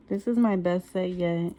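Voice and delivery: ordinary talk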